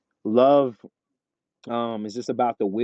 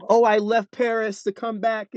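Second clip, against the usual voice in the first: high put-on lovestruck voice; mock movie romantic; 10 semitones higher than usual. Guest parodies a romance movie character, high and gushing. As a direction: imitate a gushing romance-film lover, pitched way up.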